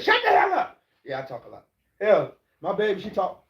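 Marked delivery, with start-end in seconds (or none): 0.0-0.8 high, loud mock yell; wife's shout. Wife yelling at him to stop talking, in a high, loud mock yell. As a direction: shriek it like the fed-up wife. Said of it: "She said".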